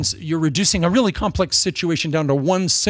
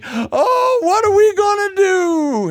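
wailing friend; 7 dB louder, slower than usual. Retold or imitated. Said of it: "someone else would come and say".

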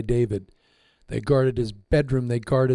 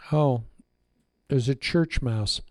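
ordinary talk, nothing marked

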